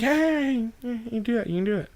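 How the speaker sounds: very high, soft little cheer; mock delight. Reacting to her suction cup plan, mock delight. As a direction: squeal agreement in a small high voice.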